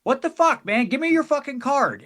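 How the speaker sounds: high, exasperated mock impatience; annoyed rock star. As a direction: imitate Les losing patience mid-song. Les annoyed, stops the song.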